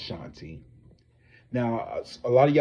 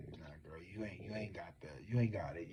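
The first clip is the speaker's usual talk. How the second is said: hushed, unimpressed brush-off; mock whispered verdict on a singer; dismissive whisper; 5 semitones lower, 17 dB quieter, faster than usual. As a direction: whisper it like a secret putdown. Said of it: "I'm kinda like".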